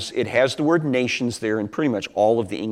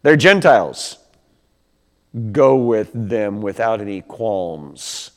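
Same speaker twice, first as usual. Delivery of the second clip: Holy Spirit speaking; 2 semitones higher, 5 dB louder, slower than usual. Retold or imitated. Holy Spirit instructs Peter, slow and solemn. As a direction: announce it slowly, like a voice from above. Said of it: "the Holy Spirit says".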